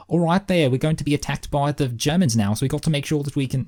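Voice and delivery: put-on high-pitched stab at a New Zealand accent; imitated New Zealand accent. Recalling the kid's battlefield line, imitated New Zealand accent. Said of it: "it sounded like to me he was going like".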